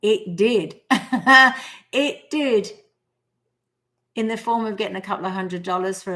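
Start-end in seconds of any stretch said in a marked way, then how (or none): 0.8-1.9 bright burst of laughter; a real, pleased laugh; happy laugh. Real, not acted. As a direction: laugh with delight. Delighted it worked out.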